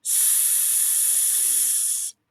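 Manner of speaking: long unvoiced snake hiss. Shown as a demonstration. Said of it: "just like what a snake says".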